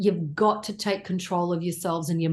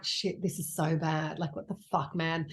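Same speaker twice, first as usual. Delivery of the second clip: much softer, mock muttered cursing; retold inner panic; 2 semitones lower, 7 dB quieter than usual. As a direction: curse quietly to yourself. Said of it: "I'm like".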